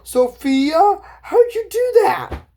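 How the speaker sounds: high, loud, amazed question; hammed-up squeal of wonder; astonished friend. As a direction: act out stunned amazement, pitch climbing steeply. Friends amazed at Sophia's transformation.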